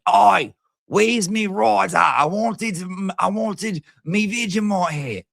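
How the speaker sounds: high, slow hammed-up Aussie accent; mock Australian accent. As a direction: whine for a Vegemite hat in broad Aussie. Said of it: "She's like".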